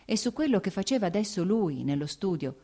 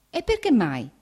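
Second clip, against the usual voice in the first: near astonishment; 5 semitones higher, 5 dB louder than usual. A narrator's reading. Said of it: "quasi con stupore".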